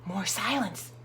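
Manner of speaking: ordinary delivery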